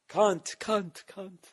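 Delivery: screamed high curses at the gear; swearing rant. Retold or imitated. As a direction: lose it at the broken mixer, high and loud. Mock rant at the mixer.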